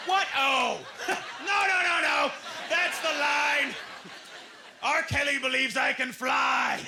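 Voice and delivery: loud, high, slowed protest; hammed-up indignant shout; overdone horrified objection; mock outrage.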